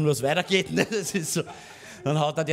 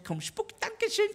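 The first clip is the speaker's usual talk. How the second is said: put-on high, slow coaxing of a toddler; sing-song coaxing; slower than usual. Father coaxes his toddler to spit out a stone, high and slow. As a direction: coax the little one sweetly, high up.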